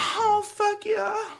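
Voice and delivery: hammed-up high moan, drawn out slowly; mock moaning. Mimicking the sex noises for the stream, high and slow. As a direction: stretch it out in a mocking high moan.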